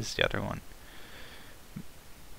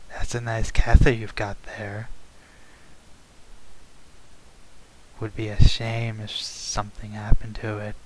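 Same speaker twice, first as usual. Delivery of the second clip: smiling threat; 2 semitones lower than usual. Pretend. Mock mafia threat to a cafe.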